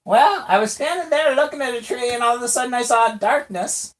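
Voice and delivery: put-on high squeaky cursed old man voice. GM plays Daryl in character.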